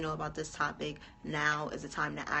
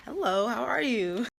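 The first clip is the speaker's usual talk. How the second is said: excited greeting; 7 semitones higher, 8 dB louder than usual. Real, not acted. Host greets arriving guest.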